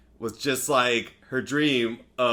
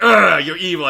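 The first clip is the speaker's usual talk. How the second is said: mock angry growl; 7 semitones higher, 10 dB louder than usual. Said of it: "It's like".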